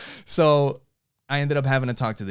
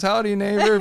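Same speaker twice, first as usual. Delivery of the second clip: 1 folksy greeting; 9 semitones higher, 4 dB louder than usual. Retold or imitated.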